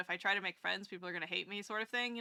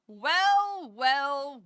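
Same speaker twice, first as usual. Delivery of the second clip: higher and louder, a hammed-up sinister well, well; mock villain; 4 semitones higher, 8 dB louder than usual. Streamer teasing a viewer arriving, mock villain. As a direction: play a cartoon schemer spotting a guest.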